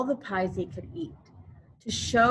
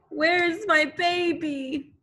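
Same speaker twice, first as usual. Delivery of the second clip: high, slow anguished plea; searching desperately for her child; mother crying out for her missing baby; 7 semitones higher than usual. Acted. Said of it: "his mother cried".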